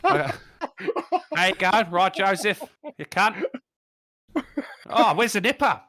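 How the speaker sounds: Australian bloke greeting Mary at the manger; Australian wise man greeting Mary; Aussie bushman accent. Pretend. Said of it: "Aussie Bushman accent".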